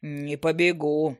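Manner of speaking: low, slow unsure gnome; unsure bass. Acted. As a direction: rumble it unsure of himself. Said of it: "неуверенно пробасил он".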